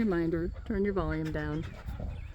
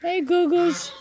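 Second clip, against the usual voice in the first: high pet greeting; 11 semitones higher, 4 dB louder, slower than usual. Real, not acted.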